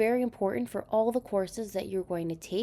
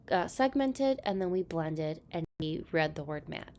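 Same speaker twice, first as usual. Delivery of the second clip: usual speaking voice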